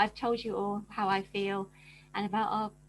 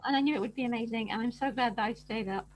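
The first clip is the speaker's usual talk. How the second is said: ordinary talk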